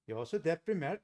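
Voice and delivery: says "Jag är så deprimerad" in a mock Norwegian singsong, rising and falling, sounding happy; cheerful Norwegian lilt while saying you're depressed. Mimicking how Norwegians say they are depressed, mock singsong Norwegian. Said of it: "They go".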